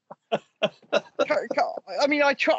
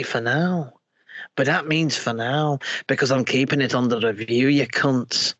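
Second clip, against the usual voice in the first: Nicola Sturgeon impression; 4 semitones lower than usual. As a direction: ham it up like a seventy-year-old who smoked eighty a day. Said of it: "my fucking Nicola Sturgeon impression".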